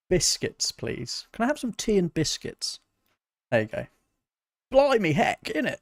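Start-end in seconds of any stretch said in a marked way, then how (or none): 0.1-2.8 hammed-up posh British accent, pitched higher; playing a tea-loving Brit in a mock British accent; jokey British caricature asking for tea. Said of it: "extremely British?". 4.7-5.8 high, over-the-top Cockney British caricature; silly British accent for the chat; mock British slang. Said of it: "extremely British?".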